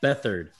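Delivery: Bethard said BETH-erd; name pronunciation variant. Shown as a demonstration. Host repeating co-host's version, Bethard as BETH-erd. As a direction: stress the first syllable of Bethard, short "erd". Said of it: "so many different ways".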